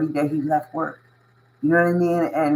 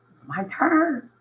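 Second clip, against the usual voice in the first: much higher and slower, drawn out; proud emphasis; 13 semitones higher than usual. Real, not acted. Telling listeners his turn to give back, proud emphasis. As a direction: lift it up, savoring the moment. Repeats the line, then lifts it.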